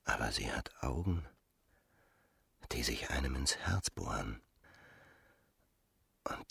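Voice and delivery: a dreamy, whispered inner voice. Acted. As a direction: whisper it as if lost in desire. Rodolphe dreaming about Emma's eyes.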